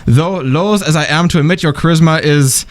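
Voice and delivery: formal email read in a German accent; mannered, courtly German-accented reading. Shown as a demonstration. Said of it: "in my German accent".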